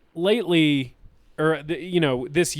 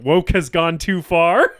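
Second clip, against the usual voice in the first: mock outrage; 4 semitones higher, 8 dB louder, slower than usual. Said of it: "she was joking".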